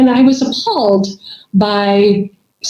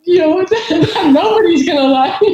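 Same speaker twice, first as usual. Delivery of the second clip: higher-pitched, cracking up while talking; laughing self-mockery; 6 semitones higher than usual. Real, not acted. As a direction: joke at your own expense through laughter. Jokes about herself, both laughing.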